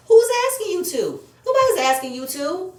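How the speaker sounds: loud, high, incredulous; who asked you, loud and fed up; exasperated outburst. Real, not acted. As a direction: fire it back in disbelief. Ranting at Marlo on recap.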